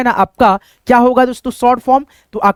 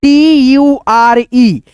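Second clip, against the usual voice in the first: टी यू आर ई spelled out slowly and louder; slow emphatic spelling; 3 semitones higher, 4 dB louder, much slower than usual. Shown as a demonstration. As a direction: spell टी यू आर ई slowly, stressing each letter. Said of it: "उसमें केवल आप देखिएगा". (टी यू आर ई को धीरे और ज़ोर से बोलना)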